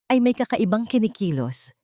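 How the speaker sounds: usual speaking voice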